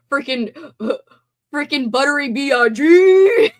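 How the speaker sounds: cracking up while shouting it out; laughing shout. Real, not acted. Laughing, excited shout of slogan.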